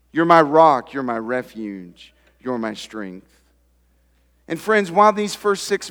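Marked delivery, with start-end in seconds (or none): 0.1-3.3 tender prayer. Acted. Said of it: "why wouldn't you say to him".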